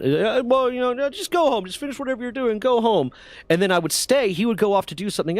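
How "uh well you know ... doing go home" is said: lightly voiced boss, pitched up high; retold boss waving someone off in a higher voice; imitating the boss. New boss telling worker to go home, lightly imitated in a high voice.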